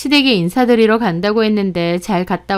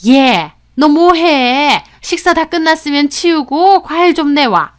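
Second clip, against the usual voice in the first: put-on high, loud, demanding older woman's order; bossy mother-in-law; 6 semitones higher, 5 dB louder than usual. A narrator's reading. Mother-in-law ordering son's girlfriend to clear up, bossy. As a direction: order her about as if it's your right. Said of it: "당연하게 시키시는".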